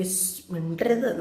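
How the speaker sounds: soft, slow hush. Retold or imitated. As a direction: hush them gently, slowly. Grandma hushing to watch quietly. (പതുക്കെ ശബ്ദം താഴ്ത്തി വിലക്കുന്നു)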